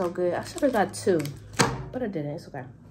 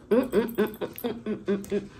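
mmm of enjoyment; 3 dB quieter than usual. Real, not acted. Savoring food.

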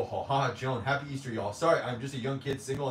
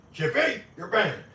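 higher, louder playful ban; mock ban; 6 semitones higher, 4 dB louder than usual. Real, not acted. Streamer to Chippy, banning him, playful loud call. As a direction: announce the ban with fake outrage.

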